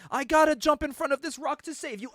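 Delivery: over-explaining comic hero, high and loud; Wolverine. Retold or imitated. Hero narrating his leap to save someone, hammed-up high shout. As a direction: announce your own heroics in a hammed-up cry. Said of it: "Wolverine's like".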